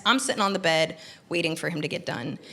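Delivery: usual speaking voice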